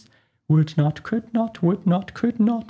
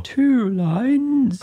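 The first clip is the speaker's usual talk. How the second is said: excited cry; 5 semitones higher, 4 dB louder than usual. Acted. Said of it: "cried the Mock Turtle".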